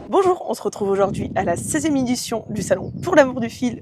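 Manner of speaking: loud, upbeat host welcome; presenter intro. Acted. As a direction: open the show like a TV host. Opens the show presenter-style. (voix d'animatrice enjouée)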